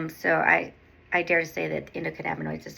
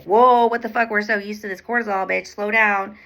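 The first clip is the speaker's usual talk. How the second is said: a panicked gut yelling at you to stop; alarmed body voice; 3 semitones higher, 7 dB louder than usual. Pretend. Her own body shouting at her, startled and loud.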